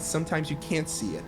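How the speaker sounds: ordinary talk, nothing marked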